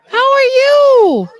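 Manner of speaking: delighted high hello; excited greeting. Real, not acted. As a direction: sing out a thrilled hello.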